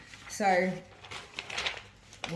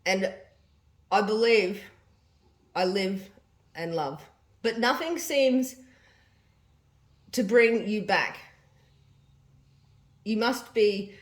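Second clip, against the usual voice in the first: diary reading; 4 dB louder than usual. Acted. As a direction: read the diary entry out with feeling. Reads mum's diary aloud.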